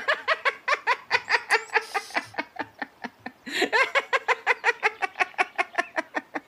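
long high cackle at its own pun; high giddy cackle. Acted. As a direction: cackle wildly at your own joke.

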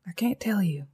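unvoiced, hushed refusal; whispered refusal. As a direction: breathe it out, barely.

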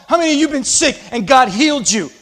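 ordinary talk

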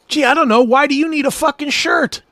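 high mocking sarcastic retort; sarcastic comeback. To the TSA agent, a high sarcastic comeback. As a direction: sneer the question back at him.

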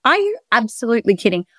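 mock shock at the price; retold disbelief. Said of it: "I was like".

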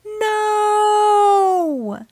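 long, high held wail; slow-motion cry. A narrator's reading. Dad too late to stop the bubble bath, slow-motion cry. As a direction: stretch it out like a film in slow motion. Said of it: "cried Dad in slow motion".

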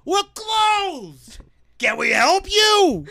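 loud, high-pitched holler; heckling stagehand's shout; yell. Acted. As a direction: yell it across a theater, dragging the last words. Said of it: "Another guy yells".

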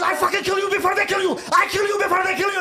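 very high, rapid-fire shouting; imitated furious shout. Dad threatening his son, fast high mock screaming. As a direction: rant fast as an enraged father.

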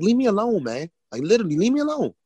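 high-pitched exasperated protest; retold angry plea to be left alone. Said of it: "now I'm getting angry".

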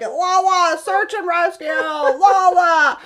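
high, loud playful shouting; mock rescue shout. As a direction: yell like a rescue team, half joking. Friend laughing, mock rescue call.

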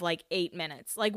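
normal talking voice

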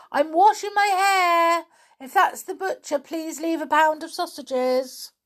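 high voice calling through a door; fairy calling out. Acted. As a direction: call out from behind the door. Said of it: "A voice came from the inside of the door".

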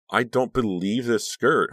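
loud, slow delighted exclaim. A narrator's reading. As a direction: burst out with delight. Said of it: "Honey exclaimed".

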